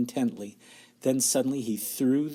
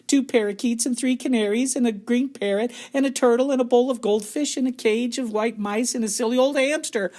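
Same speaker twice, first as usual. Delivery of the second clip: bratty shout; 12 semitones higher, 7 dB louder than usual. A narrator's reading. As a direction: whine and yell like a spoilt brat. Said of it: "shouted Veruca".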